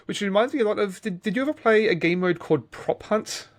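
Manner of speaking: normal talking voice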